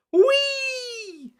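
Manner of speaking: very high squeal; gleeful whee. A narrator's reading. George zipping down the slide, very high whee. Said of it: "cried George".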